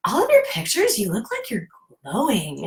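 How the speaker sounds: gushing praise. Retold or imitated. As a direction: gush like an admirer. People's messages, gushing praise.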